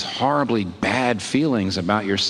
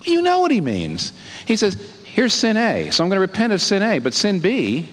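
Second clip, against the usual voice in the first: rising fervor; 7 semitones higher than usual. Real, not acted. Preacher builds to full force.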